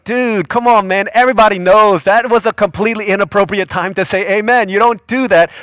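loud, high, worked-up scolding; high, loud mock rebuke; exasperated scolding. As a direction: call him out, exasperated. Narrator scolds the sleeper.

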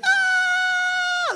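very high, loud squeal of delight; mock delighted shriek. Husband imitating wife's delighted shriek, loud high squeal. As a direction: shriek with joy at the iPad. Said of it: "she's like".

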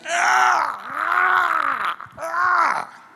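high, sharp cries of alarm, far above his speaking voice; spirit crying out. Retold or imitated. Preacher acting out his spirit's alarm in a witch's house, high yelping cries. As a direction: let out three high, startled yelps as the spirit recoils.

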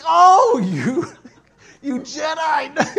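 loud high laughing shout, slow; laughing exclamation. Retold or imitated. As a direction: laugh out the word Jedi in awe. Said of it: "I was like".

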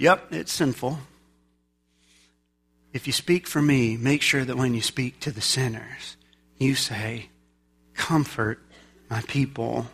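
low, soft, unhurried instruction; gentle divine reassurance. Acted. God telling Isaiah how to speak to sinners, gentle. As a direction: speak softly, with tender pauses.

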